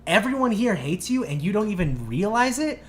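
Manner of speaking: high, loud, slow hammering insults; retold screaming tirade. Teen tells the mom everyone hates her, yelling. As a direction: re-enact a rant, leaning on each word. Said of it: "screaming at the top of my lungs".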